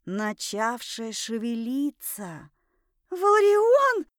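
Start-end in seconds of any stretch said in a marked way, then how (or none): 3.1-4.1 high, loud shriek of the name. A narrator's reading. Heroine calls the man as something stirs beneath her, shrieking. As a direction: scream the name for rescue. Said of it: "заверещала я".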